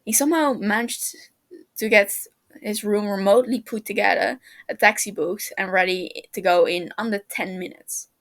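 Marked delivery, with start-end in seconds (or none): none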